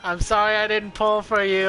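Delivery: ordinary talk, nothing marked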